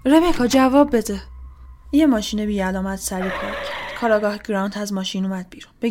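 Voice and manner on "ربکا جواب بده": loud, slow, raised call; agitated calling. A narrator's reading. Woman calls the trapped Rebecca, agitated shout. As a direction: call loudly through a locked door. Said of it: "خیلی عصبی دستگیرش رو گرفتم و تکون دادم".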